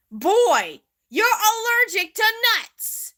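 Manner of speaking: high, loud scolding, mock exasperation; exasperated outburst. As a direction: scold a foolish friend at the top of your voice. Acted quarrel over the cookie.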